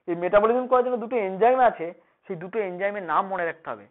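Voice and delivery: ordinary talk, nothing marked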